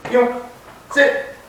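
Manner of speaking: high, crisp calls to the dog; dog commands. Real, not acted. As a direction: snap each command up high.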